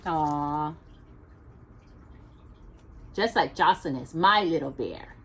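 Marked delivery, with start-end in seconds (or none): none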